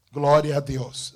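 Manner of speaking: ordinary talk, nothing marked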